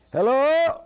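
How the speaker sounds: high, loud holler rising at the end; long-distance call with a rising tune; hollered hello. Acted. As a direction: holler HELLO up at a house, pitched high and lifting. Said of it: "He hollered hello at the house".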